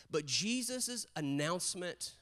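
ordinary delivery

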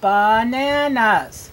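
"bananas" stretched as ba-na-nas; slow, evenly spaced "bananas", full first vowel; syllable by syllable. Shown as a demonstration. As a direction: give each syllable of "bananas" its full length. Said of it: "Three syllables".

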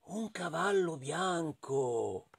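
slow, soft storyteller reveal; hushed awe. A narrator's reading. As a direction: draw it out softly with wonder. (sottovoce, lento e incantato)

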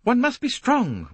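hammering home her creed; emphatic. A narrator's reading. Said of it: "wagging the turban emphatically".

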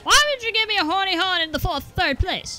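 high, fast squeaky protest; chipmunk complaint. Acted. Gamer griping about an item, fast chipmunk voice. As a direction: squeak your complaint quickly.

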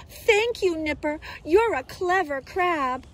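high, delighted little sea-creature voice; grateful octopus. A narrator's reading. Freed octopus to the crab, high grateful voice. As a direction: pitch it up and gush your thanks.

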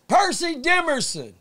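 slow, loud, high roll call of a name; officer calling name. Retold or imitated. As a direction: call the name out like a guard at inventory.

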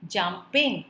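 "jumping" split as jum, ping; two distinct beats on "jumping"; syllable by syllable. Shown as a demonstration. Teacher counting two syllables, "jumping" split. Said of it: "two syllables".

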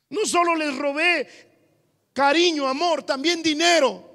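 pleading confession. Acted. Adulterer confession continues.

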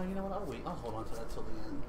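usual speaking voice

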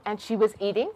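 plain reading voice

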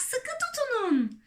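very high, drawn call at normal volume; excited warning cry. A narrator's reading. Jumping grasshopper warning all to hold on, excited cry. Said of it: "Heyecanla oradan oraya zıplayarak".

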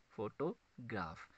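"फोटोग्राफ" broken up, with a gap before ग्राफ; split into syllables. Shown as a demonstration. Teacher repeating for students, "फोटोग्राफ" split into syllables. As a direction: say "फोटोग्राफ" slowly in parts. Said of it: "तीन syllables आते हैं".

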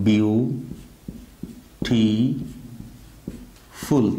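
"বিউটিফুল" split into three drawn-out beats with long pauses; broken into syllables. Shown as a demonstration. Teacher showing students a many-syllable word, "বিউটিফুল" split syllable by syllable. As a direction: say "বিউটিফুল" slowly, syllable by syllable: বিউ, টি, ফুল. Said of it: "কয়েকবারে উচ্চারণ হয়".

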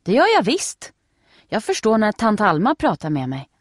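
put-on high, loud indignant little girl; insistent girl. A narrator's reading. Nelly insists to Steffi that she understands, indignant. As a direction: insist proudly, a bit offended.

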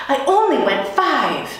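high, loud protest; shouted protest. Acted. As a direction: shout back in defence. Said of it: "Heidi shouted".

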